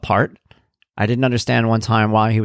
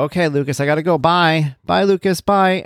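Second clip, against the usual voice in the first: playful goodbye; 7 semitones higher than usual. Acted. Father pretending to leave his son before tickling him, playful and higher. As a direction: pretend to leave, singing out bye. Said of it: "and I go".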